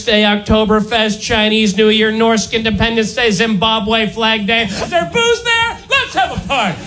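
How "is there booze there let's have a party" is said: much higher, a mock party-animal squeal; eager drunk reveller. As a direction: perk up like a drunk who smells beer. Drunk American reveller asks.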